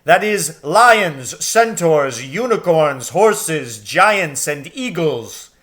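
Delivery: big, loud lion king rallying the troops; Aslan's commanding voice. A narrator's reading. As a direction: boom it out like a great lion calling his army. Aslan commands his army.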